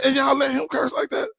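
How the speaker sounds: very high, squealed disbelief; incredulous question. Acted. Asking deacon friend why cursing is allowed, incredulous squeal. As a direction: shoot the pitch way up in protest.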